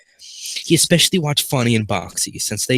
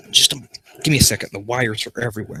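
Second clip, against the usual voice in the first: usual speaking voice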